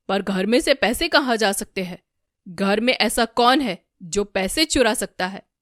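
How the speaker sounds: loud, anxious questioning; alarmed. A narrator's reading. Darpan asks who could have stolen money, alarmed. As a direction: ask loudly with alarm. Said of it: "दर्पण काफी घबराते हुए कहते हैं".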